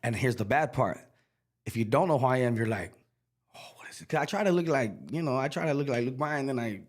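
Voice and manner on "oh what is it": whisper. Retold or imitated. Stranger puzzled who he is.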